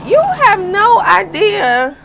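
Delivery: slow, loud and high; emphatic. Real, not acted. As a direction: drive the point home slowly. Emphatic rant at dabblers.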